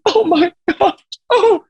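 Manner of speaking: very high mock sobbing; imitated crying. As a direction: wail it, imitating a crushed, tearful contestant. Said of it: "she's crying".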